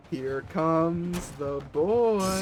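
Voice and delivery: stretched out very slow, a mock grand entrance; dramatic announcement. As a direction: drag every word out like a ring announcer. Hyping up incoming player.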